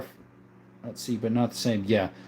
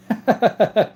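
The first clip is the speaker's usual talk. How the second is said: higher, staccato laughter; amused laugh; 6 semitones higher, 3 dB louder than usual. Real, not acted. Streamer finds a viewer funny, amused laugh. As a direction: laugh in quick high bursts.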